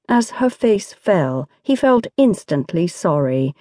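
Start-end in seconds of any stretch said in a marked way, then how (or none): none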